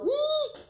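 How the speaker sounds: shrill-pitched eek of fright; high squeal. Shown as a demonstration. Coach showing Red's frightened squeal, very high. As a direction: squeal like a startled girl. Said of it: "Red, can you go".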